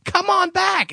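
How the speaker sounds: very high imitation of an excited fan; starstruck gushing. Starstruck tech calling him back, gushing. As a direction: gush in a high, mock-starstruck voice.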